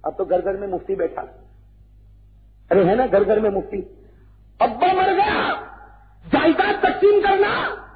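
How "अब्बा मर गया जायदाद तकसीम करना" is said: high, raised preacher's scorn; fiery sermon voice; mocking sermon. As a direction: preach against greedy sons with mock scorn. Mocking greedy heirs, preaching.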